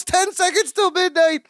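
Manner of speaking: high-pitched retold countdown warning; mock alarm. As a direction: imitate an anxious countdown call. Retold tense countdown to doom.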